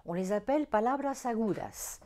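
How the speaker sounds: ordinary talk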